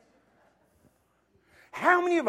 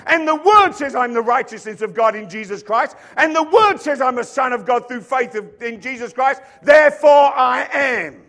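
loud, building declaration; John the Baptist shouting therefore I am; bold proclamation; 4 semitones higher, 11 dB louder than usual. Acted. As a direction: declare it louder and louder. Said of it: "and said".

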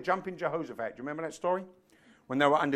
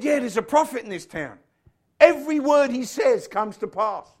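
raised, loud acted quote; emphatic testimony; 8 semitones higher, 8 dB louder than usual. Servant telling Saul about the prophet in town, emphatic testimony. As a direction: proclaim it high and forceful.

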